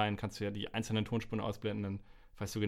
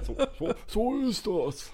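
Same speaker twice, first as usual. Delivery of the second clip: laughing; 12 semitones higher, 6 dB louder, slower than usual. Pretend. Laughing mock old-man agreement.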